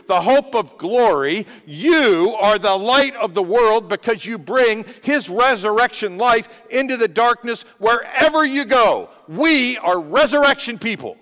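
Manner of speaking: loud, high, fervent preaching; full-force preaching. Real, not acted. Pastor preaching loudly to the congregation, fervent. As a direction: preach it out with rising fire.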